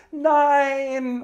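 long, high, loud cry of despair. Acted. Abandoned lover crying out as his fairy leaves, long and loud. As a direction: howl it like a heartbroken lover. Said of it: "und ist völlig unglücklich".

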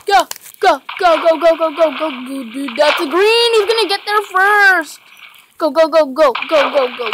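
fast loud chant of go; excited urging. Real, not acted. Gamer urging teammate to hurry, excited chant. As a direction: hurry them on, loud and eager.

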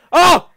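very high, loud "oh!"; real jump-scare shriek; startled scream. Real, not acted.

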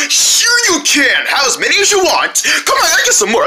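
bright, peppy snack commercial; chipper 1950s ad. Pretend. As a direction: sell it with a big grin. Kids' snack ad skit.

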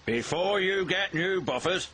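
stern lecture. A narrator's reading. Fat Controller lectures naughty Bill, stern. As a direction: lay down a condition firmly.

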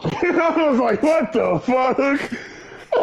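high, slow laughing what the fuck; laughing disbelief. Real, not acted. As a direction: laugh it out, incredulous.

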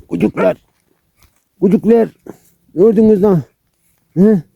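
high excited puppy talk, laughing at the end; excited cooing. Real, not acted. Owner cooing over the puppies, high and delighted.

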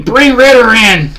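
high, loud mock chant of fans. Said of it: "dumb Falcon fans on Twitter for saying".

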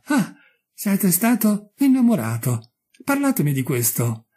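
pry with eager interest; strange curiosity. A narrator's reading. Markheim asking merchant about being in love, curious. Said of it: "con strana curiosità".

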